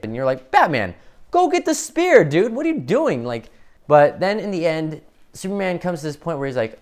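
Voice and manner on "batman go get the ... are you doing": high, loud, impatient yell; exasperated calling out. Acted. Viewer yelling at Batman to stop just watching, exasperated. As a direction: holler at him to get moving.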